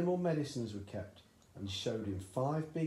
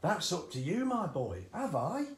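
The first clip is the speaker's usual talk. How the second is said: high, loud, eager father; eager dad; 7 semitones higher, 5 dB louder than usual. A narrator's reading. Excited father urging his son at breakfast, high and loud. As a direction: push the boy on, thrilled with the idea. Cranky voiced.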